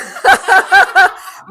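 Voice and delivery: high, loud burst of laughter. Real, not acted. Storyteller laughs at her British neighbour impression, loud high laughter. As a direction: crack up at your own story.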